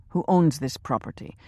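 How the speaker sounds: ordinary delivery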